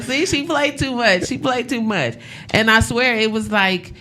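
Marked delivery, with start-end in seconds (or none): 0.0-2.2 amused scolding. Retold or imitated. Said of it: "I was like".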